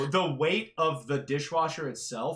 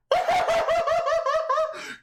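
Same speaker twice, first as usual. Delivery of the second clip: high burst of laughter; 5 dB louder than usual. Real, not acted. Laugh at the dog's noise.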